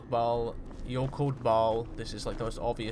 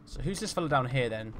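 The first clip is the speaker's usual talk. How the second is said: ordinary delivery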